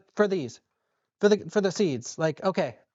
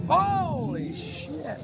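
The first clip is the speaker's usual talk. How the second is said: drawn-out surprise; 7 semitones higher, much slower than usual. Real, not acted. Surprised exclamation.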